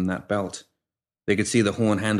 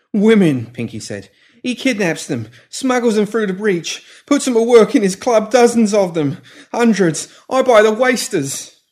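frantic; 12 semitones higher, 7 dB louder than usual. A narrator's reading. Pimp confessing in fear.

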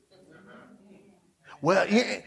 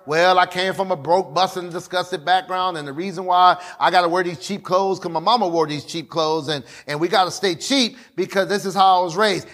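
mock excuse voice; 6 semitones lower than usual. Preacher imitating a congregant blaming upbringing, lower mock whine. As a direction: lower voice of a self-pitying congregant.

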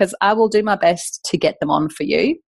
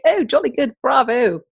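mock posh London accent; 3 semitones higher than usual. Host mocks Robin's London friends in a posh London accent. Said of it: "They don't talk like that in London".

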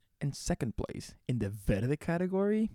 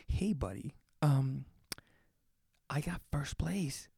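sheepish confession; 7 dB quieter than usual. Retold or imitated. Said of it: "delivered it in, like, a, "Oh, shit. Like, I did something wrong".

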